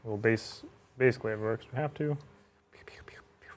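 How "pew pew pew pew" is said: soft whispered laser sounds; whispered pew pew. Pretend. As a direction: breathe the pews out unvoiced. Whispered shooting noises.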